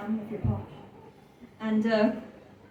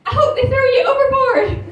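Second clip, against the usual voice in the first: much higher, louder laughing shout; exasperated granny; 13 semitones higher, 11 dB louder than usual. Retold or imitated. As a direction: yell it back at him in despair. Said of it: "my granny went".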